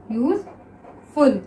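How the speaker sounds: Useful stretched out slowly; slow drawn-out reading. Shown as a demonstration. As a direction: read useful slowly, dwelling on each syllable. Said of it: "next देखिए useful". (Useful को धीरे-धीरे पढ़ना)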